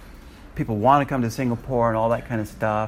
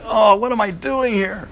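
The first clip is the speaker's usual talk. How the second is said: bewildered; 10 semitones higher, 6 dB louder than usual. Retold or imitated. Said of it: "I was just like".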